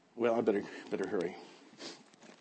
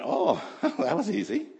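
laughing; 8 semitones higher, 3 dB louder than usual. Retold or imitated. Mock afterthought.

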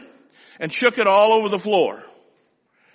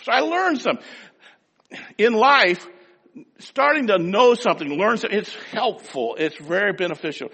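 ordinary talk

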